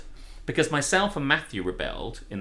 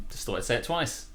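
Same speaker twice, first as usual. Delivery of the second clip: ordinary delivery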